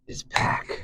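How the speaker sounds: hushed ominous whisper; whispered reveal. Acted. Josiah telling Nina her husband returned, whispered. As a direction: drop to a whisper for the twist.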